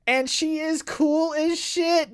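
high, slow admiring gush. Real, not acted. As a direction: draw it out in awe. Fangirling, drawn out.

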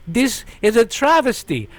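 high, loud mock Swedish accent, hammed up; bad Swedish accent. A fake Swede protesting the award loss in a bad Swedish accent. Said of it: "his accent was so bad".

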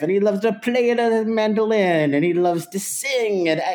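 high, exuberant cartoon Italian; sing-song mock Italian accent, like Mario crossed with Dracula. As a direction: ham up a lusty movie Italian accent. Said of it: "So he talks like this".

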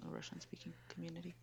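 hushed aside; whispering. Real, not acted. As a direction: whisper the apology.

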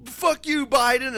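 loud, high-pitched mock outrage; high, punched mock protest. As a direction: cry out like an indignant crowd. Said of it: "then everyone's like".

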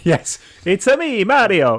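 cartoon Italian accent; Mario impression. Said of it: "over-the-top Italian accent".

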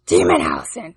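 deep growling demon voice. Pretend. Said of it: "the demon voice".

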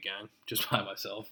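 ordinary delivery

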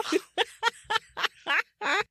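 high squealing giggle; a delighted fangirl squeal of a laugh; giggling over a favourite trope; giddy giggle. Real, not acted. And I'm giggling.

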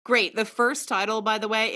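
ordinary talk

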